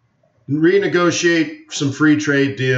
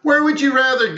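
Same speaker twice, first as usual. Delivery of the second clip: incredulous protest; 10 semitones higher than usual. Real, not acted. Protesting a viewer's comment.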